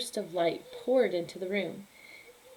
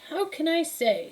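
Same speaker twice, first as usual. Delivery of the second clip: put-on high, brusque old lady voice; dismissive old woman; 9 semitones higher, 4 dB louder than usual. Befana brushing off the kings, high curt old woman. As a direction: brush him off like a cranky crone.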